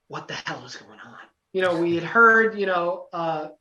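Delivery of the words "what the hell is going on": low, very quiet aside in disbelief; hushed bewilderment. Retold or imitated. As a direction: mutter it low, as if privately baffled. Retold bewildered reaction to being mic'd.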